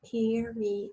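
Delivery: "period" slowly in three parts; slow, measured "period" for spelling; syllable by syllable. Shown as a demonstration. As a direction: sound out "period" one syllable at a time. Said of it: "think about those three syllables".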